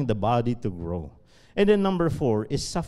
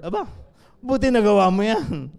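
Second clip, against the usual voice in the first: sarcastic retort; 6 semitones higher, 6 dB louder than usual. Retold or imitated. Pastor's sarcastic retort in story.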